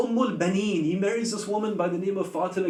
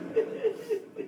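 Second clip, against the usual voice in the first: choked sob; 12 semitones higher, 7 dB quieter than usual. Real, not acted. Weeping preacher breaks mid-story.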